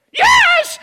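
very high, full-volume shout; touchdown cheer. Acted. Preacher mimicking a fan at a Dallas touchdown, cheering shout. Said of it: "Like he scored a touchdown".

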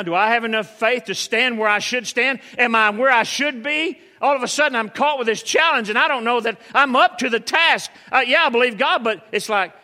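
loud, high, urgent preaching; preaching at full force. Acted. Preacher voicing a worried mate facing a challenge, full-force preaching.

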